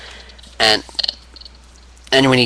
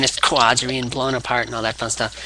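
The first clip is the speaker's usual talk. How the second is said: ordinary talk, nothing marked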